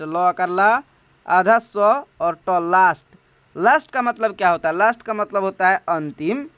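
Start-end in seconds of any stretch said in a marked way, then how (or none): 0.0-2.5 "ल कर ला, आधा स और ट" built up slowly sound by sound. Shown as a demonstration. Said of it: "इसका उच्चारण चेंज होकर के बनता है लास्ट". 2.7-3.0 "लास्ट" said firmly as English last; model word. Shown as a demonstration. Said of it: "बनता है लास्ट".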